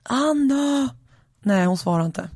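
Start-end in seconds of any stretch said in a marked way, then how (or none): 0.0-0.9 high, drawn-out name call; acted husband's holler. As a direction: call the wife in a raised pitch. Husband calls wife from kitchen.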